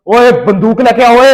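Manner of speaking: slow, loud bark for the gun; loud call. Retold or imitated. Father calling the guard for his gun, loud and slow. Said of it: "ना ऊंची आवाज में कहा".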